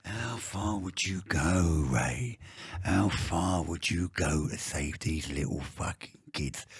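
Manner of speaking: slow, low villain threat; menacing villain. Acted. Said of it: "if you look at my reel".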